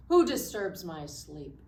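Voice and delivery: lion voice. Acted. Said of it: "The lion said".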